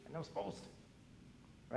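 slow, breathy whisper, almost to himself; whispered confession. Retold or imitated. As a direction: whisper it like a guilty secret. Pastor repeats the duty to himself.

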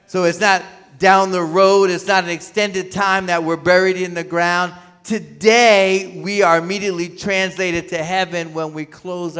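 loud, ringing preacher's call; full-force preaching. Acted. Pastor telling the congregation paradise is today, loud preaching. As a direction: drive it home to the congregation at full volume.

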